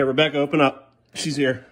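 ordinary talk, nothing marked

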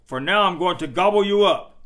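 loud, quick troll threat. A narrator's reading. Troll threatening to eat second goat, loud and quick. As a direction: bellow it fast and menacing. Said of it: "said the troll".

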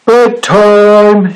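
high, drawn-out storybook shout. A narrator's reading. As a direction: call out bedtime as the Snorg. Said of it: "shouted the Snorg".

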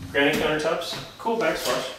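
ordinary delivery

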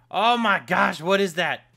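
loud astonished outburst; shocked exclaim. Real, not acted. Player seeing a huge mob, loud shock. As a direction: blurt it out in shock.

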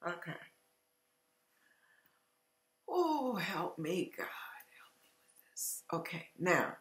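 hushed sigh and whispered prayer; overcome, catching breath. Real, not acted. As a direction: pull yourself together under your breath. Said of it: "let me take a breath".

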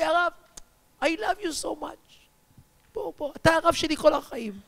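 high, stretched-out declaration; pleading affection. Retold or imitated. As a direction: call out love in a high voice. Man calls back to his rabbi.

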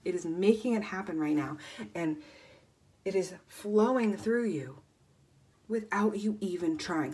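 ordinary talk

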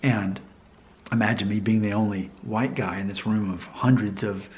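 usual speaking voice

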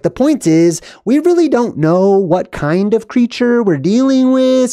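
high-pitched mock diplomatic voice; careful, high-voiced imitation of the daughter. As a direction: mimic a daughter hedging, pitched well up. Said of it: "the daughter picks up and she's like".

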